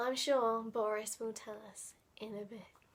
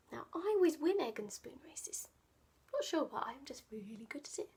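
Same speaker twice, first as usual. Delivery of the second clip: quick whispered aside; 6 dB quieter, much faster than usual. Real, not acted. Boastful aside to children.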